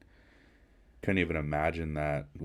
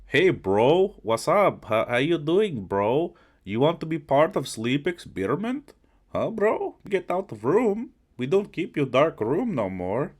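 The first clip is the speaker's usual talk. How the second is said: high, loud mock Russian accent; broken English in a comic Russian accent; put-on Russian accent; 8 semitones higher, 5 dB louder than usual. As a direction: ham up a pushy Russian captor's accent. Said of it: "let me do the accent".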